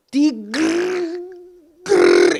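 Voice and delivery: przeciągnij "grr" jak warczenie, nisko i chrapliwie; growled grr sound. Shown as a demonstration. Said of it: "¿Y cuál es la grr?".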